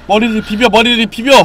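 loud high command; urgent shout. Real, not acted. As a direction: yell the order.

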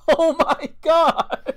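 high, loud, drawn-out delight; helpless laughing exclamation. Real, not acted. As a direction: stretch it out while cracking up. Cracks up at remembered scene.

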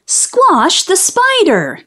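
high, stretched-out alarm; mock screamed order. A narrator's reading. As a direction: cry it high and slow. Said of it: "screamed Billy".

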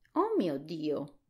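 slow, drawn-out cry of dismay; a slow "oh my God" at a friend's blunder; dismayed exclamation. A narrator's reading. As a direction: gasp it out, slowly, as if shocked. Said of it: "esclamò lo spaventapasseri".

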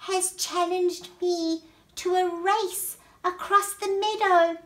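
high, loud snail voice; worried snail. Acted. Snail tells gathered friends of the challenge, high and loud. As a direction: announce it urgently, pitched right up.

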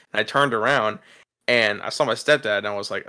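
usual speaking voice

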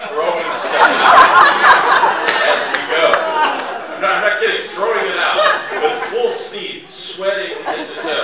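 real laughing excitement, rising to a near shout; telling the climax of the chase, laughing loudly; laughing excitedly. Real, not acted.

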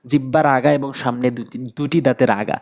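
normal talking voice

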